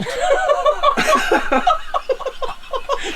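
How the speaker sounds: high, long, giddy laughter; elated laugh. Real, not acted. Angler marvels at his giant catch, elated laugh. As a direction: let out a real high-pitched laughing fit.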